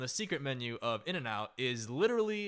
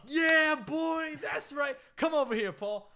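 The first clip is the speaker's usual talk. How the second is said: much higher, loud whooping; rowdy cheering shout; 12 semitones higher, 5 dB louder, slower than usual. Retold or imitated. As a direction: whoop at your friend like a sports crowd. Friends cheering Paul's order.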